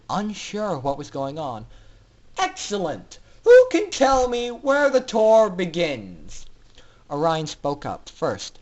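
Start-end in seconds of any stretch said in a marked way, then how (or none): 2.4-6.5 higher, louder, contemptuous scientist; mock the prisoners with cruel pleasure; sneering villain doctor. Doctor Atmosphere sneering at the captive foals, loud and cruel.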